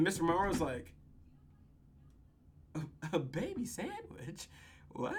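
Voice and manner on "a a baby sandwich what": very soft, halting question; hushed, stammering mock bewilderment; stunned disbelief. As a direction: barely get the words out, baffled. Acting Mister Monroe stunned by answer.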